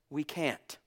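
normal talking voice

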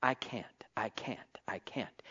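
whispered defeated "I can't"; mock despair. Pastor imitating believers saying they can't, whispered. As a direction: sink into hushed hopelessness. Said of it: "we get to saying".

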